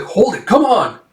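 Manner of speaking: high-pitched plea to hold on; acted mid-fight protest; urgent protest. Comrade protests during the fight.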